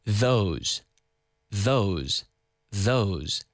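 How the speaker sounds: "Those" with the tongue visibly out; "Those" with a clear voiced th. Shown as a demonstration. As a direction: show your tongue on the th of "Those". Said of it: "Look at my tongue".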